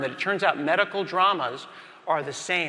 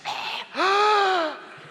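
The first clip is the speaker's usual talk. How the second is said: shocked gasp. Acted. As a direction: gasp as the pen goes in. Climax of pen stabbing.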